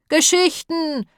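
high, drawn-out sheep's cry; bleating sheep. A narrator's reading. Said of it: "blökten sie".

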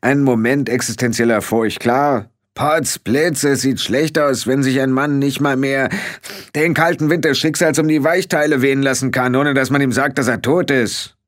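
blustering indignation; indignant. A narrator's reading. Rob indignantly denies being dead.

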